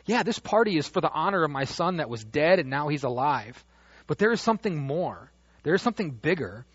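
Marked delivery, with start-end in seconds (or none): none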